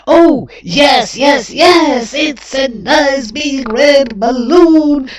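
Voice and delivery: put-on high, loud, eager chorus of small kids; children shouting together with delight; excited children. A narrator's reading. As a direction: cheer it like a crowd of happy children. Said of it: "say the twelve little children".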